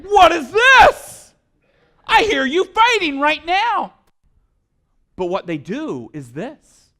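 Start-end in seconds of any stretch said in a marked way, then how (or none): 0.0-4.0 high, loud and slowly drawn out, caught them at it; mock indignant surprise. Spouse catching partner bickering, with mock indignant surprise. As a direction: squeal it in mock outrage. Acted old couple bickering.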